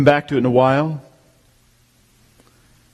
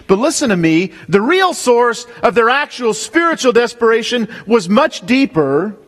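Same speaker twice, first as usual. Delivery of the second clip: preaching; 6 semitones higher than usual. Acted. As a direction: drive it home from the pulpit. Preacher builds to full force.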